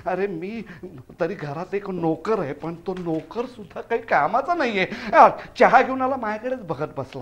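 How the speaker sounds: low, soft muttered complaint; grumbling. Acted. Husband complains of useless servant, grumbling quietly. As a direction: complain to yourself under your breath.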